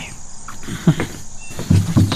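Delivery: soft breathy villain chuckle; sly under-the-breath laugh; villain's laugh. Acted. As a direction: laugh quietly to yourself over the wicked plan. Villain chuckles at his scheme.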